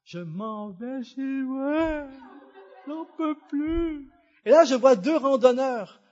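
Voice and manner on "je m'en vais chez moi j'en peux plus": slow, drawn-out mock sobbing wail; tearful wail. Exhausted lost driver alone in the mountains, wailing tearfully. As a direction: whimper it slowly, as if breaking down. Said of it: "Je me suis effondré en larmes".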